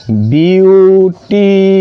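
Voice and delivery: ब्यूटीफुल stretched out as byoo, tee, phool; ब्यूटीफुल slowly split into its sounds; syllable by syllable. Shown as a demonstration. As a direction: say ब्यूटीफुल very slowly in three drawn-out parts. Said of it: "तीन तरीके से निकल रहा है".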